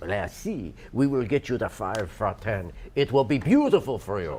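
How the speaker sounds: put-on low French accent; mad Frenchman accent. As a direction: answer as a deep-voiced mad Frenchman. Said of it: "the mad Frenchman".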